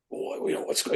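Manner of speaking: breathy whisper, barely audible; hushed whisper. Retold or imitated. Buddy peering down the ledge, whispered puzzlement.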